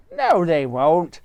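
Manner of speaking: high bird voice; roly-poly bird. Acted. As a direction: chirp it like a friendly bird. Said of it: "said the roly-poly bird".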